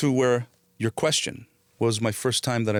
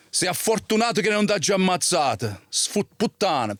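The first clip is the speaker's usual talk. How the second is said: menacing Italian threat; 7 semitones higher than usual. Acted. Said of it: "in Italian, okay, I said".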